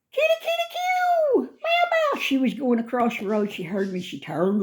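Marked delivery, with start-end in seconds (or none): 0.1-2.2 loud, very high kitty call with meows; cat call. Real, not acted. Calling a stray cat.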